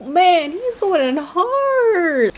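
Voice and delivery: high, slow, impressed exclamation; excited. Real, not acted. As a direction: marvel at the verbal takedown. Streamer reacts to heated game argument.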